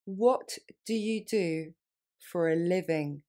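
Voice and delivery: slow, split model phrase; slowly. Shown as a demonstration. Teacher slowing down for French learners. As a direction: break it up and go slowly. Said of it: "Plus lentement".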